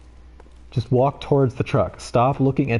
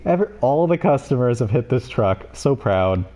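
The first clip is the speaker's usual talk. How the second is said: usual speaking voice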